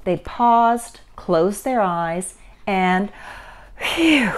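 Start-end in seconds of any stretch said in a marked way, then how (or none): 3.8-4.3 long blown-out breath; breathy "phew". A narrator's reading. Mother and child calm down after splash, deep exhale. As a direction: blow out a falling "phew". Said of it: "let out a deep breath".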